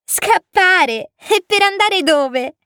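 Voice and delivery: put-on high child voice, incredulous question; amused little prince. A narrator's reading. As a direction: echo it in an amused piping voice. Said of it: "rispose voltandosi un'ultima volta verso di me divertito". (vocina acuta, domanda divertita)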